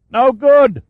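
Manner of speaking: high, loud shout from below. Acted. Caldweiler shouting up the shaft to Shaw, loud. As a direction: yell it up the shaft. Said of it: "Caldweiler shouted up".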